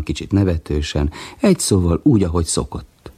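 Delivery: ordinary delivery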